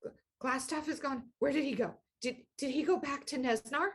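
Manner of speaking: high, quick, fretful goblin voice; anxious little goblin. Acted. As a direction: rattle off worried questions in a small high voice. GM voices the frightened goblin asking.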